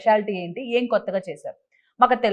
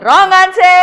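high, loud game-show verdict; loud announcement; 8 semitones higher, 9 dB louder, much slower than usual. Real, not acted. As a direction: proclaim the verdict to the whole room. Host announces a wrong answer. (గట్టిగా, హెచ్చు స్వరంలో ప్రకటిస్తూ)

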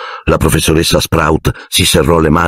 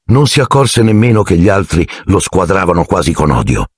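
plain reading voice

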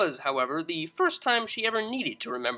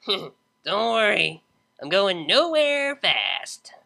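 slow, slurred, amused drawl; lazy joke after a laugh; tipsy DJ reassuring friend, slow; drunk Vinyl; 4 semitones higher, 3 dB louder, much slower than usual. Acted.

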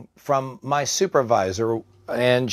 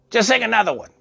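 higher-pitched imitation of a patient; demanding old patient; 9 semitones higher, faster than usual. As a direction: give a short, high-voiced order.

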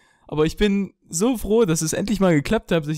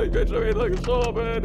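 normal talking voice

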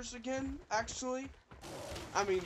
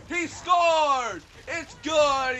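loud, raised commentator cheer; excited sports call; 8 semitones higher, 10 dB louder, much slower than usual. Pretend. Player hailing his own dinosaur throw, loud sports call. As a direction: call it like a winning goal.

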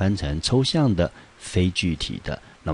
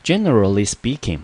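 Generally punched loud and high, speaking with a clear ING; stress on Generally; 5 semitones higher, 5 dB louder than usual. Shown as a demonstration. Teacher modelling the phrase again, louder. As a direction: hit Generally, finish speaking crisply. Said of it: "speak后面一定要加上I N G".

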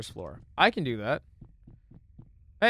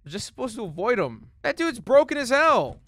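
high, loud yelling; exasperated rant; 10 semitones higher, 9 dB louder than usual. Real, not acted. Player ranting at an overpowered enemy, exasperated. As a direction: protest at the top of your voice.

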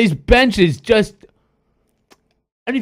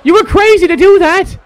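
loud, high shout of triumph; excited shouting; 7 semitones higher, 4 dB louder than usual. Real, not acted. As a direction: roar it like the block just happened. Hyped call of a block.

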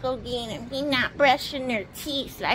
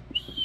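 extremely high, soft squeal; toy dragon cry; 8 dB quieter than usual. Pretend. Voicing the toy dragon, very high squealed cry. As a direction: hold one piercingly high pretend whistle-squeal. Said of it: "Here, Dragon go".

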